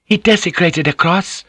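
high-pitched, mildly shocked question; a touch of scandalised disbelief; openly shocked. Acted. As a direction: take it in with open shock. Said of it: "openly shocked".

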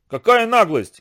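loud, high indignant shout. A narrator's reading. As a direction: call out from the crowd. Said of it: "крикнул кто-то из толпы".

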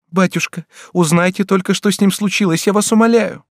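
high, rising desperate plea; begging daughter. A narrator's reading. Daughter begs father.

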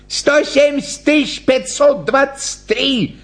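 high-pitched call from the crowd; workman's shout. A narrator's reading. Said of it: "крикнул мастеровой". (высокий выкрик мастерового)

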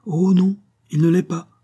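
high, loud protest; distressed denial. A narrator's reading. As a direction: refuse to believe it, voice lifted. Woman denies the man's death.

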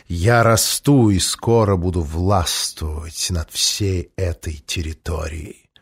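low, slow, arrogant dragon voice; a scornful dragon looking down on humans; velvet dragon voice. A narrator's reading. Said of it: "бархатный голос". (низкий надменный бархатный голос дракона)

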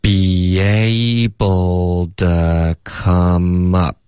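very slow, held syllables on a level tone; slow practice. Shown as a demonstration. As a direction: stretch every linked syllable for learners. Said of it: "我们就第二段". (极慢速拉长音节示范)

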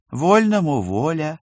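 slow, grand permission; majestic. Acted. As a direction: grant it like a queen. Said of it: "величественно разрешила женщина".

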